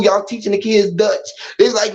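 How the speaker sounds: normal talking voice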